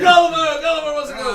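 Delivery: loud, high announcement of the name; hyped-up announcer call; excited announcing. Real, not acted. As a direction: call it out like a ringmaster. GM announcing the volunteer.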